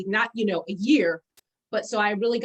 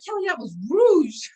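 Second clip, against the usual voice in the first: loud, slow, pitched-up disbelief; retold dismay; 7 semitones higher, 5 dB louder, slower than usual. Jerry discovering Kelly used rouge on her face, loud dismay. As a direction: break it to her, stretched and high. Friend revealing the mistake.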